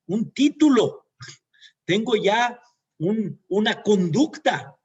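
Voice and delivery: rising alarm building to a loud cry; dawning realization. Retold or imitated. Son realizing he has a temper, rising to a loud exclamation.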